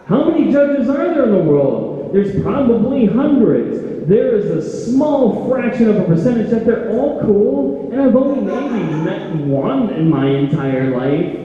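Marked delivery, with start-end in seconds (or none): 0.0-8.9 higher, louder frantic inner monologue; panicked racing thoughts. Acted. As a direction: spiral into panic counting judges.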